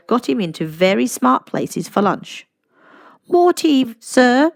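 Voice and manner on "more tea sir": higher, louder polite waiter; much higher, louder attentive waiter voice; waiter Walter. A narrator's reading. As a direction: offer tea like an eager waiter. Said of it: "asked Walter, the waiter".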